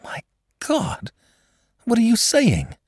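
a high breathless gasp of shock; horrified cry. Acted. Girl hearing her benefactress is dead, crying out in shock. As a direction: recoil in horror at the news. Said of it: "cried the girl".

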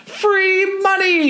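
high, slow drawn-out shout; shouting free money. A narrator's reading. Kid calling to crowd from roof, long high shout. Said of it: "you shout".

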